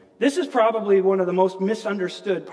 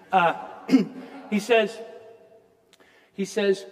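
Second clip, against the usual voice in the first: usual speaking voice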